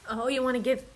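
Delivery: ordinary talk, nothing marked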